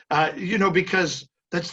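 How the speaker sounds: normal talking voice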